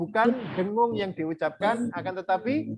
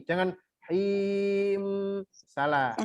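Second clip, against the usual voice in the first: ordinary talk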